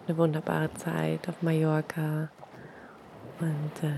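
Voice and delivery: ordinary talk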